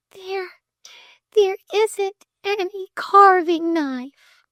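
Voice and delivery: put-on high, slow, small-girl lament; whispered, tearful. A narrator's reading. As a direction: admit it haltingly, close to tears. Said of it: "whispered Flame".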